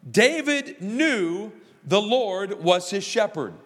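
slow, weighty preaching line; slow emphatic statement. Acted. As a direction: lay each word down slowly. First statement of point one.

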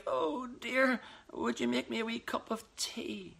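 feeble, whimpering sick voice; weak pathetic voice. Retold or imitated. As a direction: play the helpless sick husband. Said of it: "I'll put on my weakest, most pathetic voice".

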